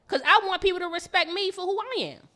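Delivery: ordinary talk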